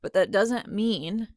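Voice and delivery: ordinary talk, nothing marked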